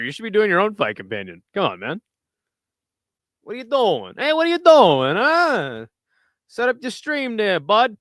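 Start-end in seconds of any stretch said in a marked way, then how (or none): 1.5-8.0 joking Canadian wiseguy, pitched up; Canadian mafia guy. Acted. Streamer teasing a viewer about streaming, as Canadian mafia guy. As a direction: play a comic Canadian mobster. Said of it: "Now I'm a Canadian, uh, Mafia guy, eh?".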